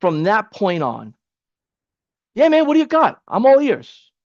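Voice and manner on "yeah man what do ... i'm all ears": high, upbeat "I'm all ears" voice; a buddy leaning in, all ears; eager business owner. Pretend. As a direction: play the keen prospect, pitched way up. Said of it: "role play that".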